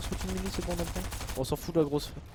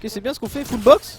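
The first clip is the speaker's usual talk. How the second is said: excited; 4 semitones higher, 10 dB louder, slower than usual. Real, not acted. Teammates box up, caster cheers.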